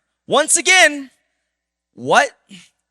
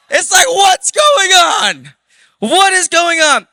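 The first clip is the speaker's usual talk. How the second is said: incredulous shout; 11 semitones higher, 9 dB louder, slower than usual. Real, not acted. Disbelief at the wild story.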